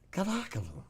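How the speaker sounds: high cooed pet name. Real, not acted. Owner calling the husky, high baby talk.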